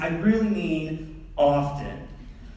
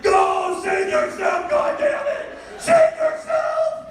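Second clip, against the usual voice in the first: much higher, slow desperate yelling; dramatic dying shout; slower than usual. Pretend. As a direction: scream like a fallen soldier in battle. Kid playing dying soldier.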